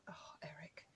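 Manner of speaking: breathy whisper under her breath; whispered aside. Real, not acted. Exasperated owner whispering to her pest of a cat. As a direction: whisper the cat's name in exasperation. Muttering about the pesky cat.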